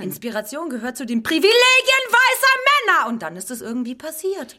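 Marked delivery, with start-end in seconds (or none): 0.0-3.1 high, loud declaiming; declaimed outcry. Acted. Woman shouts the slogan back at the questioner. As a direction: proclaim it like a protest slogan.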